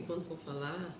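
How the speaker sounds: ordinary delivery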